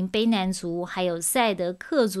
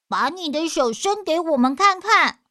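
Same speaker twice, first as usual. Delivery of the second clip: put-on wary children; 8 semitones higher, 6 dB louder than usual. Children demand to see hand.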